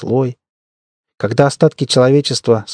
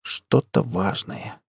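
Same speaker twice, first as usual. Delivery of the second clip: very soft and slow; hushed reply; 3 semitones lower, 10 dB quieter, much slower than usual. A narrator's reading. Max answers his friend before the tree, hushed. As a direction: answer under your breath, slowly.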